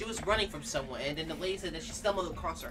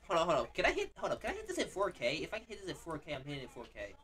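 normal talking voice

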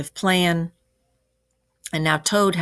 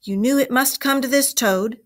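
higher-pitched, emphatic telling-off; stern reproach; 7 semitones higher, 4 dB louder than usual. A narrator's reading. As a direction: scold him as a disappointed elder. Badger scolding Toad in story.

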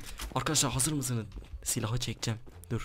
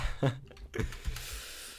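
quiet breathy laugh; 5 dB quieter than usual. Real, not acted. Laughs at the enemy.

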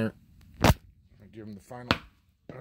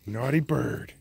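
ordinary delivery

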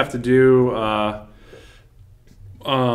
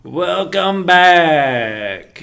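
very slow, high, drawn-out Texan; Matthew McConaughey voice; 7 semitones higher, 5 dB louder, much slower than usual. Pretend. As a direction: drawl it out like McConaughey. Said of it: "a Matthew McConaughey voice".

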